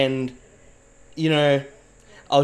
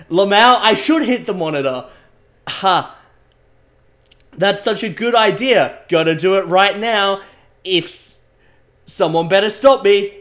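louder evil scheming, mock maniacal; hammed-up evil glee; 4 semitones higher, 6 dB louder than usual. Streamer playing evil to chat, loud mock menace. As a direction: play the villain about to smash the monitor.

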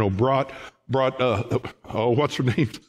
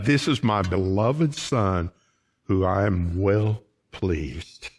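low, slow solemn divine voice; voice of God; 7 semitones lower, slower than usual. Retold or imitated. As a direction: speak it down slowly like God from heaven. God's voice from heaven quoted.

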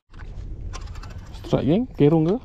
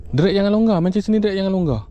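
urgent warning; 6 semitones higher than usual. Real, not acted. Angler warning about loose drag, urgent call. Line running out while fighting fish.

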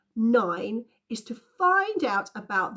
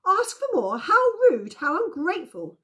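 high, loud cries of indignation; outraged shouting; 11 semitones higher, 7 dB louder than usual. A narrator's reading. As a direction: exclaim in scandalised outrage, pitched way up. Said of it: "The men shouted, the women screamed".

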